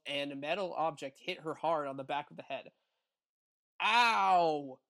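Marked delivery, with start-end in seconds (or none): 3.8-4.8 sharp pained cry; yell in pain. Acted. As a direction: yelp as if bitten. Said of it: "yell out in pain".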